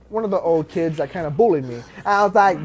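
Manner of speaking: usual speaking voice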